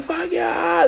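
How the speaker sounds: high mock crowd yell; crowd cheer. Party crowd cheering the brawl, high yell.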